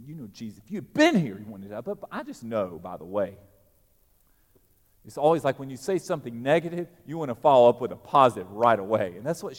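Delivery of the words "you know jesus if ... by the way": quieter, mock-sweet backpedalling after a jab; backtracking sweetly. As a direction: soften it, as if smoothing over what was just said. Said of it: "then she backtracks a little bit".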